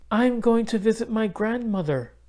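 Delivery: put-on light, high girl's voice; young girl Isabel. A narrator's reading. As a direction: lift the voice up for the little girl.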